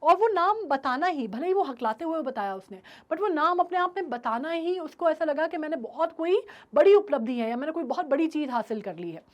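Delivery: usual speaking voice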